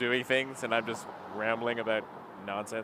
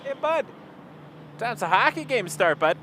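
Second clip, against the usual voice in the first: higher, hammed-up hoser Canadian accent; mock Canadian accent; 6 semitones higher than usual. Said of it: "maybe I didn't call my viewers hosers".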